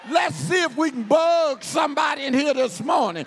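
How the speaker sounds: fervent pulpit shout; full-force preaching. Acted. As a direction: preach it loud to the church.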